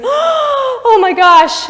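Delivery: huge gasp, then high, drawn-out cry; hammed-up horrified realization; super dramatic shock. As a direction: gasp and wail it, long and loud. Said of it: "super dramatic".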